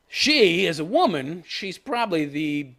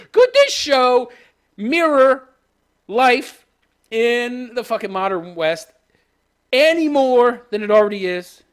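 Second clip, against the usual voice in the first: louder, higher, slow indignant outburst; exasperated rant; 6 semitones higher, 6 dB louder, slower than usual. Real, not acted. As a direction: hammer each word in disbelief. Ranting at the show.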